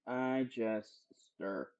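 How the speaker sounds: low, soft and drawn apart; hushed repeat. Shown as a demonstration. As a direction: drop low and soft, pausing before the last word. Repeats phrase, hushed and deliberate.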